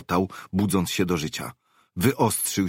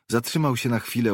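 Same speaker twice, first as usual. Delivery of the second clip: ordinary delivery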